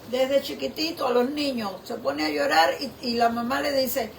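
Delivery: usual speaking voice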